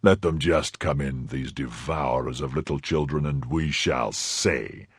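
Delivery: low, unhurried and composed; calm menace. A narrator's reading. Athos behind the door daring the intruders in, calm. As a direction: keep it calm and low, slowing at the end. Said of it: "cried the calm voice of Athos".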